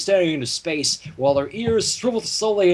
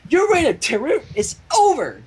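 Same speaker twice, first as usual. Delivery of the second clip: defiant hero; 10 semitones higher than usual. Acted. Applejack defies villain.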